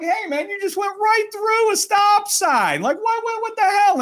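high-pitched indignant retold yell; indignant shouting. Retelling his shout at a driver.

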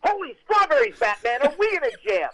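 high, loud, excited sidekick exclamation; Robin voice. Shown as a demonstration. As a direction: cry out like an eager boy sidekick in trouble. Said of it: "when I do my Robin voice".

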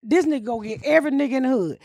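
ordinary talk

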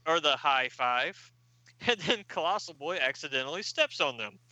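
plain reading voice

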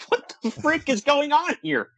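high, slow disbelieving outburst; stunned, what-is-this squeal; baffled. Retold or imitated. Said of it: "I'm like".

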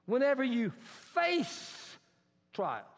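"face" pitched high and drawn out; stress on face. Shown as a demonstration. Preacher highlighting a scripture word, "face" high and stretched. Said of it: "that word face".

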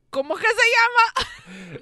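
high, loud frustrated cry of forgetting; exasperated outburst. Real, not acted. Friend recapping a show, forgetting the name, loud exasperated cry. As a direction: blurt it out high and loud, straining to remember.

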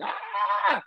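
high hoarse cry of overload; short frazzled exclamation. Retold or imitated. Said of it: "it's just like".

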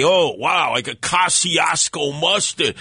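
ordinary talk, nothing marked

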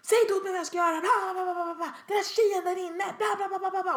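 high-pitched angry babble; mock hysterical complaining; screaming customer. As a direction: imitate a shrieking rant trailing into blah-blah. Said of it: "bara stod och skrek".

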